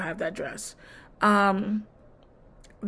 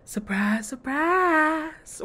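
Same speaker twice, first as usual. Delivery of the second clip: singsong sarcastic surprise, second word stretched higher; mock surprise; 6 semitones higher, much slower than usual. Real, not acted. Recapping a predictable plot turn, mock surprise. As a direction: sarcastically draw out the second word, pitch rising.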